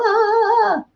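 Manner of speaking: a high flustered "Ah"; mock frazzled cry. Showing viewers that frazzled feeling, with a high mock cry. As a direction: act out a sudden frazzled moment.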